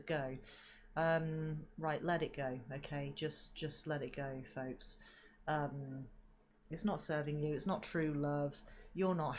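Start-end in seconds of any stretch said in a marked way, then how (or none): none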